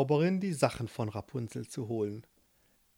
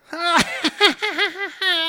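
high loud witch cackle; 14 semitones higher, 8 dB louder than usual. Acted. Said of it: "Da lachte die Hexe".

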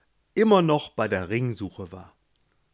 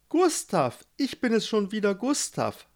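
whiny Gustav; 9 semitones higher than usual. Acted. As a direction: moan about the unfair world, much higher. Gustav calls at the gate. (Gustav jammert hoch und wehleidig über seine Brüder)

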